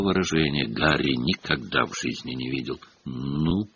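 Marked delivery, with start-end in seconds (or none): 3.0-3.7 low, glum question; gloomy. A narrator's reading. Myrtle gloomily answering Hermione, low and sullen. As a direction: ask it gloomily, under the breath. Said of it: "мрачно спросила она".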